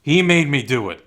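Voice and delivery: hammed-up frantic denial; mock guilty denial. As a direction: play a killer whining that it wasn't his fault. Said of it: "and he's like".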